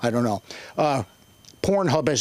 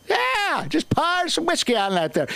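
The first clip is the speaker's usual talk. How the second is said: mock Irish drinker; 13 semitones higher than usual. Mocking Irish stereotype again.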